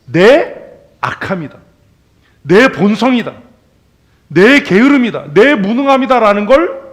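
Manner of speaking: high, loud fervent sermon climax; preaching at full force. Acted. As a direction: drive each point home from the pulpit.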